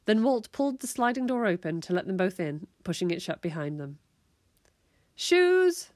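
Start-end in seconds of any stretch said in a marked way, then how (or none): 5.2-5.9 high, loud one-word order; sharp parental call; mum yelling. A narrator's reading. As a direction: yell it out to the kids. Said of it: "their mum yelled".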